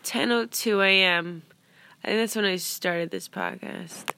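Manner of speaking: normal talking voice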